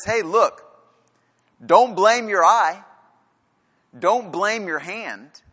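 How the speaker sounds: voicing Jesus as he pleads with the crowd; emphatic voice of Jesus. Preacher voicing Jesus, slow and earnest.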